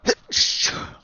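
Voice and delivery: loud sneeze; sudden sneeze into the mic; sneezing. Real, not acted. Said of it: "Sorry for the sneeze".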